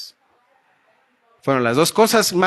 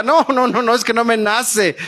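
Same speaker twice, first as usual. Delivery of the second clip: objecting churchgoer; 7 semitones higher than usual. Retold or imitated.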